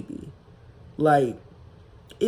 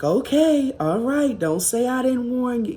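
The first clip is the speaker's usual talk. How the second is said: high, loud singsong warning, imitating a naysayer; imitated gloom-and-doom caution, pitched way up; mock doom warning; 8 semitones higher, 4 dB louder than usual. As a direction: mimic her foreboding warning in a raised voice. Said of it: "gloom and doom type of negativity".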